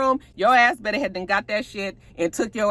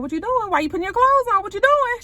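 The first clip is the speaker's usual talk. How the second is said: alarmed yell; 13 semitones higher than usual. Real, not acted. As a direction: shout at the screen in disbelief. Yelling at character on screen.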